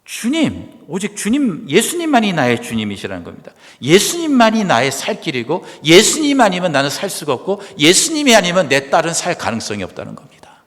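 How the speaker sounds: loud, high impassioned sermon; fervent preaching. Acted. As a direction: preach it with everything you have. Preaching at full force.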